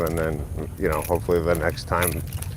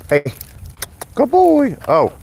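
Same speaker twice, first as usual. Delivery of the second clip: high praise; 6 dB louder, slower than usual. Real, not acted. Owner praising big dog, high excited coo. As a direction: gush over him like a puppy.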